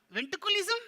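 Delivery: high, loud puppet question; baffled puppet. Pretend. Puppet garbles the word back.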